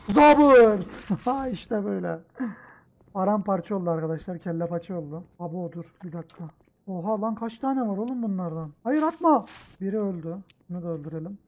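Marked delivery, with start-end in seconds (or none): 0.1-1.1 loud, higher shouted sound word; exclaimed attack noise. Real, not acted. Player blasting an enemy in the game, shouting. 8.8-9.4 louder, high-pitched urgent cry; alarmed shout. Real, not acted. Player telling an enemy not to throw, shouting.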